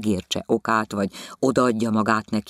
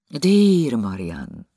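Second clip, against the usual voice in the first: slow, sighing lover's name; sighed name; 2 semitones higher, 4 dB louder, much slower than usual. A narrator's reading. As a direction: breathe the name out longingly. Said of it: "sóhajtott".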